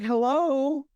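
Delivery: high, drawn-out sing-out into the dark; hammed-up horror heroine calling; mock calling out. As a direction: call out in a high put-on voice. Acts character calling into dark.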